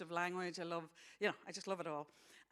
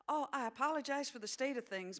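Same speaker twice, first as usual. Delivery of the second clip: put-on young mother character; 7 semitones higher than usual. Young mother apologizes for mess.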